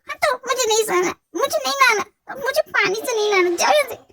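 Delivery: high-pitched petulant protest; whiny refusal. Acted. Drunk girl refusing a wash from her helper, whiny protest. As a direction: whine and push them away.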